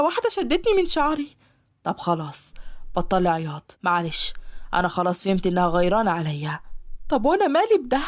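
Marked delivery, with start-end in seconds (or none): 1.8-6.6 softened master. Acted. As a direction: comfort her a little. Ashhab softens.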